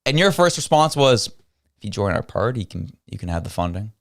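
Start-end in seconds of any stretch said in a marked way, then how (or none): 1.8-3.9 mock quiet offer. Commentator mocks minister's offer again.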